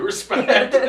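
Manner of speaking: amused quote dissolving into a big laugh. Real, not acted. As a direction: crack up while quoting it. Host cracking up at the irony.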